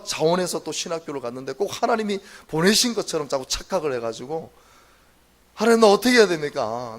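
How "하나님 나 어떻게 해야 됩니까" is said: loud pleading cry to God; a preacher reliving his desperate prayer; anguished cry to God. Retold or imitated. Retold prayer crying out to God.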